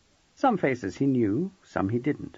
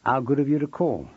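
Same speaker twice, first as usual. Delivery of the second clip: plain reading voice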